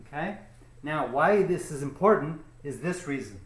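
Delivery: usual speaking voice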